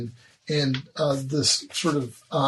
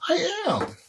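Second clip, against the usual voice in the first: Crystal, pitched very high. Acted. NPC answer pitched high.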